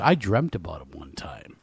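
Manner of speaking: spooky whisper. Retold or imitated. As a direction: breathe it out like a ghost story. Daughter's creepy line.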